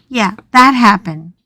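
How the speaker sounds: ordinary delivery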